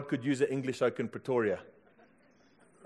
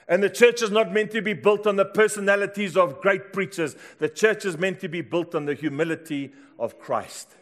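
raised, driving sermon delivery; full-force preaching; 4 semitones higher, 6 dB louder than usual. Real, not acted. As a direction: proclaim it to the whole congregation.

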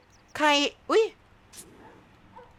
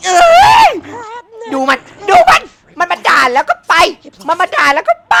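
much higher, louder crying shout; outraged wail; 9 semitones higher, 12 dB louder than usual. Real, not acted. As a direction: wail in outrage as she leaves.

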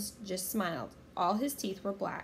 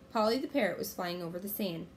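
ordinary delivery, nothing marked

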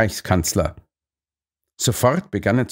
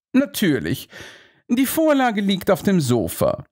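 Holmes voice; 7 semitones higher than usual. Acted. Holmes figure voice.

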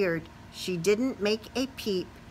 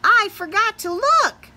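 higher, louder and frantic; high alarmed spider voice; panicked; 12 semitones higher, 7 dB louder than usual. Acted. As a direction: shout it while sinking. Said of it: "yelled the spider".

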